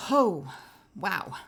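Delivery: usual speaking voice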